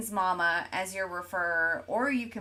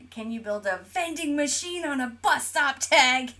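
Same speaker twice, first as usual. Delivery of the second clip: louder, higher voice for a chat read in all caps; reading in caps lock; 4 semitones higher, 6 dB louder than usual. Retold or imitated. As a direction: read it like it's shouted in capitals. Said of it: "with his caps lock".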